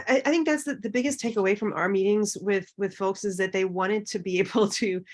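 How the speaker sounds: ordinary talk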